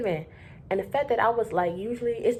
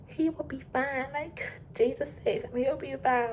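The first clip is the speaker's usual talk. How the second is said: higher, soft, naive reassurance; hopeful inner voice; 7 semitones higher, 4 dB quieter than usual. Retold or imitated. The clerk reassuring herself about the guest, higher and soft. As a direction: tell yourself it'll be fine, lightly. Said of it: "I'm thinking, I'm like".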